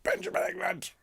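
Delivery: high calling of a full name; mock telling-off by mum; a mild imitation of a mother calling her child in; mother's scolding call. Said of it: "when my mother always shout".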